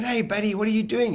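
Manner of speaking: a high call of hey buddy down a corridor. Retold or imitated. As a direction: hail a mate running past. Calling out to mate in passage.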